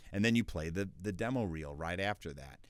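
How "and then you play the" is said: usual speaking voice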